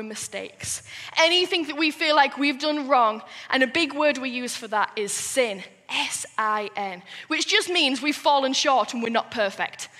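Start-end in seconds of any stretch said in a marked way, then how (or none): none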